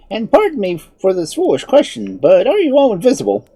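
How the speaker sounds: high-pitched, eager wizard; inquiring wizard. A narrator's reading. Wizard questioning unseen people, curious and apologetic.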